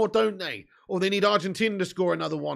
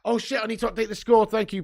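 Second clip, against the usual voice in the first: ordinary talk, nothing marked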